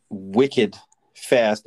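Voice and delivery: wicked fast in a light Boston accent. Shown as a demonstration. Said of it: "if I can bring my Boston accent into this".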